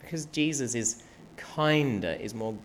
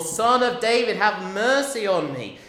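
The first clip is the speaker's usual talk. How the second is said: shouting for mercy; 9 semitones higher, 9 dB louder than usual. Acted. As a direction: shout over the crowd. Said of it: "he shouts all the more".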